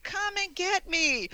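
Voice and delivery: high, drawn-out singsong dare; playful taunt. Retold or imitated. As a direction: imitate a cocky guy calling out to a ghost. Ron jumps on bed, taunting ghost.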